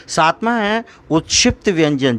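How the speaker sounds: usual speaking voice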